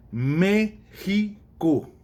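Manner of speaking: "México" bem lento, Mé-xi-co, sílaba por sílaba; sílabas separadas. Shown as a demonstration. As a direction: soletre "México" devagar, marcando o MÉ. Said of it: "Tem uma separação e tem uma dessas que é tônica". ("México" arrastado, separando as sílabas)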